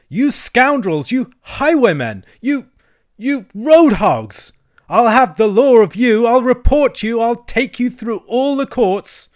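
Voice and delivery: high, very loud tirade, words drawn out; furious shouting. Acted. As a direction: hurl abuse in a high, outraged bellow. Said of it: "he shouted, shaking both fists".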